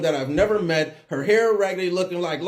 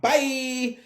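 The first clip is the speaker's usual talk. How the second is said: comic bailing out; 9 semitones higher than usual. Retold or imitated. Acts out fleeing the creepy situation.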